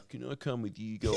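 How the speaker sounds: put-on soft, drunken plea; drunk friend. Drunk friend asks to tag along, imitated soft drunken pleading. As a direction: imitate a drunk mate asking very softly. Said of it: "his friend was so drunk".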